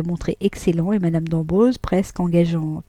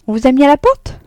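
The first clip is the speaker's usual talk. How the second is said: higher and louder, mocking; mocking question; 7 semitones higher, 8 dB louder, faster than usual. A narrator's reading. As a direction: tease him that he was thrown out. Vatnaz raille Frédéric. (voix moqueuse, plus aiguë et plus forte)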